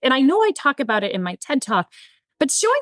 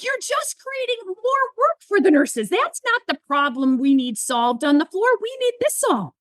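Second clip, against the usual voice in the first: urgent objection; 6 semitones higher than usual. Retold or imitated. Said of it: "And I was like".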